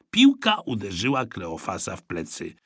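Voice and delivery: plain reading voice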